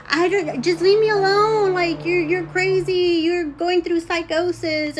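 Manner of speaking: slow, stretched-out exasperated accusation; angry daughter. Retold or imitated. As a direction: imitate a daughter snapping back. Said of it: "and she got angry".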